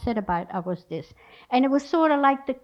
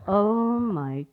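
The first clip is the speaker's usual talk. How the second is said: slow, low groan of disbelief; retold inward exasperation, sinking softer; dismayed aside; 3 semitones lower, 4 dB quieter, much slower than usual. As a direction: drag it out wearily under your breath. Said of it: "I'm going".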